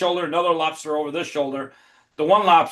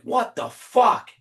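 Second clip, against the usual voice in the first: stunned what the fuck; 7 semitones higher, much slower than usual. Retold or imitated. Seeing the lobsters race around the oven, a slow stunned outburst. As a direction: let the disbelief stretch out.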